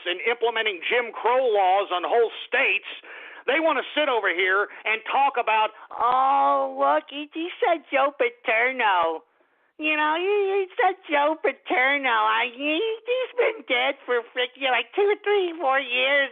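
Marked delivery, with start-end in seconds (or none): 5.9-16.3 put-on, drawn-out silly voice; mocking the media. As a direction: imitate carping commentators with derision. Mocks media talking about Paterno.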